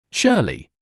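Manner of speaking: startled cry of the name; suddenly spot her, alarmed; alarmed exclaim. A narrator's reading. Noelle spotting Shirley, a startled cry. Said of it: "Noelle exclaimed".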